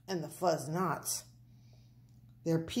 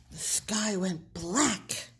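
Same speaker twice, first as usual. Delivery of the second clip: usual speaking voice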